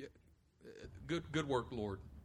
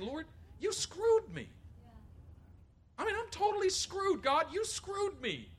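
exasperated complaint; 12 semitones higher, slower than usual. Retold or imitated. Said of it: "That's what he's saying".

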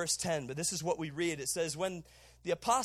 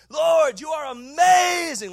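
loud high cry, amazing drawn out slowly; shouted prayer; 8 semitones higher, 12 dB louder, slower than usual. Retold or imitated. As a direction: cry out to the Lord at full voice, slowly. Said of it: "like that".

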